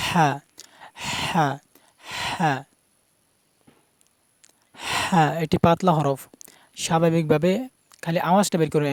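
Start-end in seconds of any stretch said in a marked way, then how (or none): 0.1-5.2 "হা" with a breathy rush from mid-throat. Shown as a demonstration. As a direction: push air from the middle of the throat into "হা". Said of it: "গলার মধ্যখান থেকে উচ্চারণ হবে". ("হা" গলার মাঝখান থেকে)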